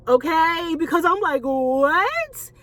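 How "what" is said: drawn-out "what" sweeping upward; mock-stunned, rising wail of a word; retold disbelief. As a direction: act out an incredulous, stretched question. Retelling her tearful disbelief.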